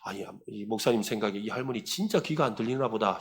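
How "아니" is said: ordinary delivery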